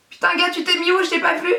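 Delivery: high, baffled outburst; startled exclamation. Real, not acted. Vlogger hunting the misplaced lash, high startled exclamation. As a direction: demand it in high-pitched alarm.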